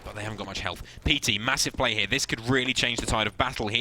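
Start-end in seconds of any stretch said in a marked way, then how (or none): none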